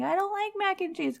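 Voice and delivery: put-on high, whiny child's complaint; whiny kid. Mom imitating a picky kid refusing dinner, high and whiny.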